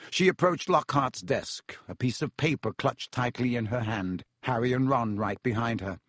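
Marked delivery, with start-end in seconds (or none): none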